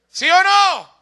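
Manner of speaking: high, very loud demand for agreement; booming call for a response; challenging shout. Real, not acted. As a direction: fire the question at the congregation. Preacher challenges congregation loudly.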